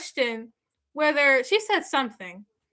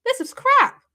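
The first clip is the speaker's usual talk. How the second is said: mock officer outburst; 11 semitones higher, 5 dB louder than usual. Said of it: "police officers that's like".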